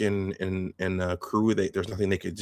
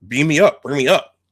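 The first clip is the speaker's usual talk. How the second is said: raised, high-pitched urgent order; urgent command; 4 semitones higher, 9 dB louder than usual. Retold or imitated. Commander Kroog demands a beam-up, urgent call. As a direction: call out loudly to be beamed up.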